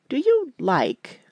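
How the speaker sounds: slow, high, hesitant question; doubtful. A narrator's reading. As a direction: draw it out with doubt. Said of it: "said Bobby doubtfully".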